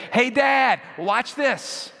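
put-on high, loud, excited child's call; kid calling out to Dad. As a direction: call to your dad across the waves. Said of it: "you will hear the kid say".

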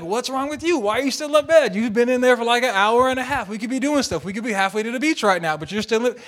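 high, loud exasperated nagging; heated retort. Retold or imitated. Husband nagging wife still in bed, loud exasperation. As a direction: fire back, acting out a worked-up husband. Acted quarrel, husband's side back.

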